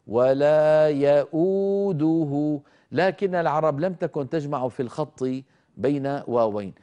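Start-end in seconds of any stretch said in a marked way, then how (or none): 0.1-2.6 ولا يؤوده slow, hamza with damma then a clear و; tajweed recitation. Shown as a demonstration. Teacher reciting ولا يؤوده for students. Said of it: "أنطق همزة مضمومة وبعدها واو".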